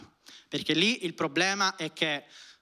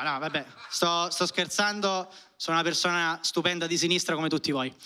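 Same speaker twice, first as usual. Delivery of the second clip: ordinary talk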